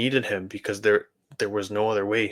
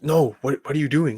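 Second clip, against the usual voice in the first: pitched up high in alarmed disbelief; a friend hears the Bible was thrown out, reacts in high shocked alarm; shocked outburst; 5 semitones higher, 3 dB louder than usual. Retold or imitated. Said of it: "and then she was like".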